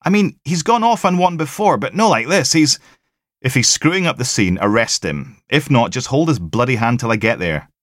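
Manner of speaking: loud, high, fed-up instructions; exasperated orders. Acted.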